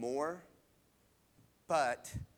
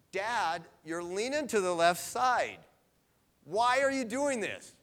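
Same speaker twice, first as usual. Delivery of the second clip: raised, higher voice, alarmed and pleading; exasperated call to dad; 7 semitones higher, 7 dB louder than usual. Retold or imitated. Daughter calling out to her leaning dad, raised and exasperated. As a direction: call out to him, half-laughing, half-scolding.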